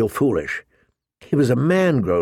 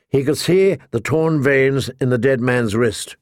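ordinary delivery

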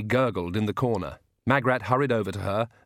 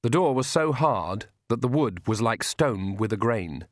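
usual speaking voice

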